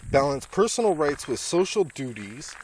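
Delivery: ordinary talk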